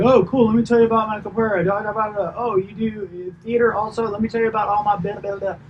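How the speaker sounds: high, gushing mock braggart; chatty self-absorbed trainer. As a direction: ham up a trainer who won't stop talking. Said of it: "They're like".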